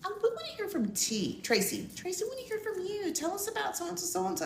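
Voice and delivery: light high wife impression; imitating her wife. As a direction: coax the shy guest to speak, sweetly. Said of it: "my baby was like".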